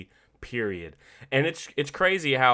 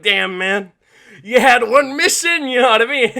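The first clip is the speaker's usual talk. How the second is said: mock dismay; 9 semitones higher, 11 dB louder than usual. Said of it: "Angry Joe and other Joe were like".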